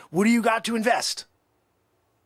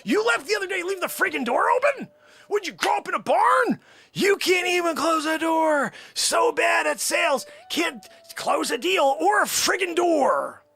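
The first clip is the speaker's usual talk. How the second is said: berating rant; 6 semitones higher than usual. Acted. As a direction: tear into him for being useless. Berating Tim about door.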